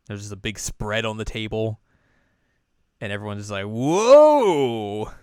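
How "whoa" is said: loud, drawn-out awestruck cry; awed whoa. Retold or imitated. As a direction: let the amazement swell, higher and louder. Everyone amazed at the spread.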